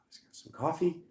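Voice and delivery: hushed whisper, settling down; calming whisper. Real, not acted. Reader calming down after getting riled, whispered. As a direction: whisper it to yourself to cool off.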